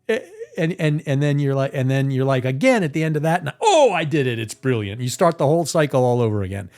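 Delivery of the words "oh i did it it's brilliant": hammed-up self-congratulation; a burst of relief; mock triumph.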